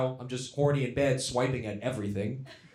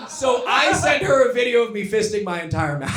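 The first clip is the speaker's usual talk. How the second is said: loud, laughing brag; excited shout; 9 semitones higher, 9 dB louder than usual. Real, not acted. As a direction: yell it like a proud boast. Hyped punchline, shouted.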